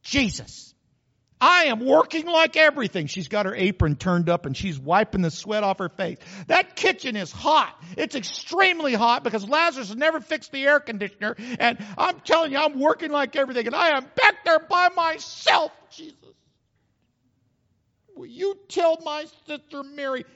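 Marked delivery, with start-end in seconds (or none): 0.0-3.1 high, loud, put-upon complaining; exasperated Martha. Acted. 6.5-20.2 high, loud, put-upon complaining; exasperated Martha. Acted.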